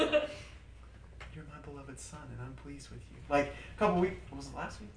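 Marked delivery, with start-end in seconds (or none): 0.0-3.2 slow, much softer, gentle assurance; calm and tender. Retold or imitated. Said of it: "spoke to me calmly".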